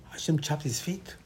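hushed unvoiced whisper; whispered aside to the listeners. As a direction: breathe it out as a secret. Whispered line, repeated plainly after.

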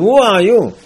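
sharp, loud demand; loud challenge. Acted. Yaakov loudly challenging the Satan over the cans. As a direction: bark it at the intruder.

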